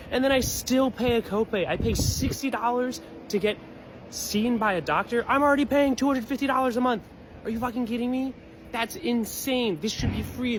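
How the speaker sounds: furious rant. Real, not acted. Man ranting to viewers about copays, furious. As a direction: let the outrage climb as you list the costs.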